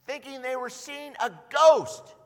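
half-acted panic, loud and high; loud alarm. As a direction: sound as frightened as they were. Describing the disciples freaking out.

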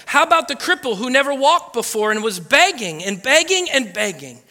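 rally the church with each example; rising preaching. Real, not acted. Pastor rousing the church with Jesus' healing stories, preaching.

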